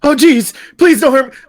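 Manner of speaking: high, loud frightened plea; scared yelp. Acted. Startled stranger pleading in fear. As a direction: cower and beg not to be hurt.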